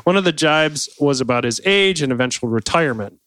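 usual speaking voice

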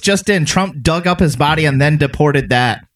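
breaking news announcer, hammed-up; mock news flash. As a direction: announce it like a joke bulletin. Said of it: "mock news bulletin again".

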